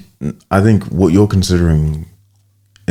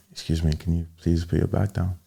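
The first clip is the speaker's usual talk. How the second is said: much softer, gentle polite request; 11 dB quieter than usual. Shown as a demonstration. Showing his friend how to ask a commuter politely and softly. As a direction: ask nicely so nobody feels told off. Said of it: "approach the situation a bit, like, softer".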